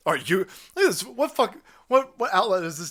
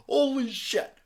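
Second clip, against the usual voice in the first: normal talking voice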